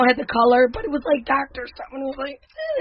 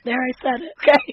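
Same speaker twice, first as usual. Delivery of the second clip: usual speaking voice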